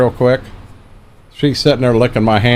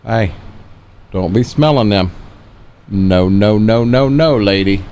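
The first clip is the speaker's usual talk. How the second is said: ordinary delivery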